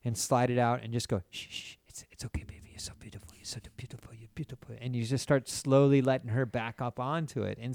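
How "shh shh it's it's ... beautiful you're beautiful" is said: soft breathy whisper, coaxing. Shown as a demonstration. Man demonstrating to friends what to whisper to her. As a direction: whisper it right into her ear, soothing. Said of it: "always whisper in her ear".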